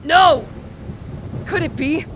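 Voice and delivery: high, loud shocked gasp of disbelief; shocked cry. Acted. Victor seeing his dead brother's ghost, shocked. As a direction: recoil in horror and disbelief.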